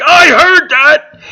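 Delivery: high, loud, slow outraged cry; indignant shriek. Retold or imitated. Woman overhearing the dad at the barbecue, shrieking in outrage. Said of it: "the woman's like".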